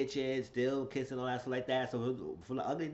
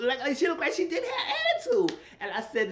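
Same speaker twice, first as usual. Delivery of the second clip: laughing; 5 dB louder than usual. Real, not acted. Laughing while recounting.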